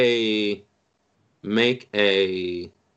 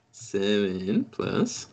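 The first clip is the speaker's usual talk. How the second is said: ordinary talk, nothing marked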